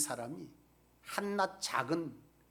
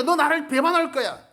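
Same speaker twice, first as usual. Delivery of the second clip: higher, loud solemn warning; Jesus warning; 10 semitones higher, 13 dB louder than usual. Retold or imitated. Jesus warning Peter of betrayal, loud solemn voice. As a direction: declare the betrayal to come, loud and high. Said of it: "주님께서 경고합니다".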